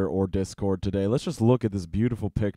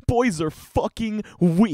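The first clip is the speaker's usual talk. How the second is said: slower mock outrage; 9 semitones higher, 3 dB louder than usual. Real, not acted. Outraged reaction to post.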